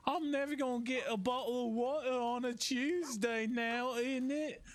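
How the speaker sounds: lightly put-on British voice, teasing; British impression. Teasing British viewers in a mock British accent. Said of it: "my British impression".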